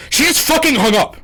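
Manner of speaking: high, loud outburst of outrage; livid. Real, not acted. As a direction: explode with disbelief at being hung up on. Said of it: "I'm gonna get livid at certain points".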